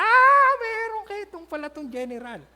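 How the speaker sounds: a sudden high, loud gasp, then a gossiping tone; shocked bystanders spotting the leprosy. Acted. Crowd at the river, shocked at the general's leprosy.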